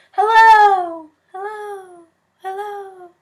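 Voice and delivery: high calls fading away like an echo. A narrator's reading. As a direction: make it bounce back from far mountains. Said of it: "his own voice echoed off".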